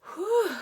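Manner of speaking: high, loud gasp of relief; relieved whew. A narrator's reading. Mouse relieved the dog left, gasping whew. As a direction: breathe out in relief. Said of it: "gasped Ralph".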